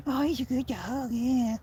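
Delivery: put-on high cooing baby-talk praise; doting dog parent. Dog owner imitating how dog parents praise their pets, high cooing. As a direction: coo at the dog like a proud parent. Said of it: "you're a dog parent".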